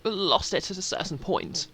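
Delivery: loud, high-pitched, grandly proclaiming knight; Sir Gilman. Acted. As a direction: announce yourself at full volume like a questing knight. Voices Sir Gilman introducing himself in game.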